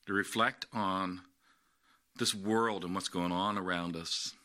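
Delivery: low, soft, unhurried prayer voice; bow your head and pray gently with the congregation. Acted. Pastor leading the congregation in prayer, soft and low.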